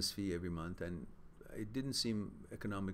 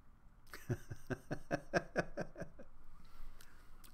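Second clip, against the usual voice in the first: soft chuckle; 5 semitones higher, 6 dB quieter than usual. Real, not acted. Speaker amused at his own long talk, soft chuckling. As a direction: chuckle softly and at length.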